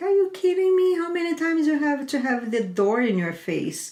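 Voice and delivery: high, fast retold outburst of disbelief; rapid-fire scolding, starting very high; incredulous sister. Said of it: "And my sister like".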